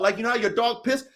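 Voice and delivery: ordinary talk, nothing marked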